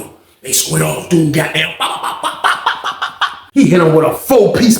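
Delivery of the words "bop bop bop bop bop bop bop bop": punch sounds. Retold or imitated. Imitating punches in fight.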